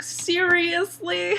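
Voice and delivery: imitating a fed-up girl; exasperated disbelief. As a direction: stretch it out in mock exasperation. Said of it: "and she's like".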